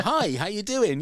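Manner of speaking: high-pitched retold greeting; disembodied radio voice. Absent host suddenly greeting guests, lightly voiced radio greeting. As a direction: lightly imitate an unseen host popping in. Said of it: "disembodied voice that all of a sudden goes".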